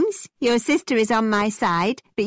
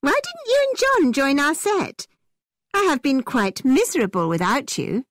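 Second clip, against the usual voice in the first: excited cry; 6 semitones higher than usual. A narrator's reading. Isabella finally finds her dear friend at the ball, excited. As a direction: greet them in an excited, higher voice. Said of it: "in an excited voice cried".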